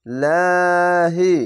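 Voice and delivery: drawn-out chanted reading of তাকুলু, vowels held long; slow Quran recitation. Shown as a demonstration. Teacher demonstrating tajweed to students, slow chanted recitation. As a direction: recite তাকুলু slowly, stretching each vowel. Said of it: "এক আলিফ টেনে পড়বেন".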